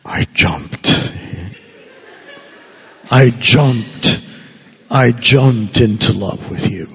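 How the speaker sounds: starts in a whisper, then a playful mock declaration; mock lover. Shown as a demonstration. Priest teasing the congregation with a mock love confession. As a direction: gaze deep and gush it, half joking. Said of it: "look deeply in your eyes and say".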